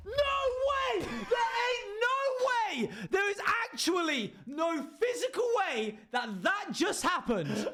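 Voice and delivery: very high, drawn-out shriek; overacted disbelief. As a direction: scream the line in hammed-up outrage, way up high. Overacted take of the monologue.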